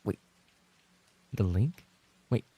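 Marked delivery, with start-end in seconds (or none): none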